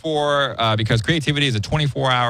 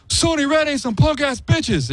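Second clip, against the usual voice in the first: high, slow mock yell, much higher in pitch; yelling the Baldwin line; slower than usual. Yelling Stephen Baldwin's line down the phone, high mock yell. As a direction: shout his own line back at him. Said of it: "I just yelled on the phone".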